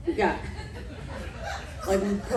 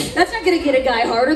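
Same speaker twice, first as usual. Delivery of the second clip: usual speaking voice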